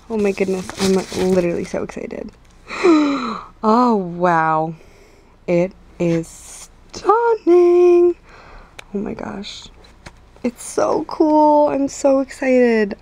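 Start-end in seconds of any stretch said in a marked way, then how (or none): 2.7-8.2 awestruck. Real, not acted. First look at the camera. 10.4-13.0 excited. Real, not acted. Testing the new camera.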